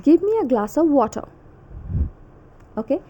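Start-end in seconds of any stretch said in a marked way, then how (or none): 0.0-1.3 attempted British accent, higher pitch, r dropped from "water"; British r-less "water". Shown as a demonstration. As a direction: request it British-style, "water" ending in uh. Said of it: "So in British accent".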